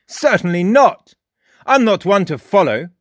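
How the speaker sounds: high, loud indignant bark. A narrator's reading. Frost barks back at Jake, high and loud. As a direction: snap the two words back.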